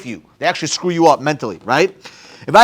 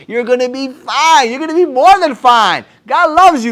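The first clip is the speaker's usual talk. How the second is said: building encouragement; 5 semitones higher, 4 dB louder, slower than usual. Retold or imitated. Building encouragement to younger self.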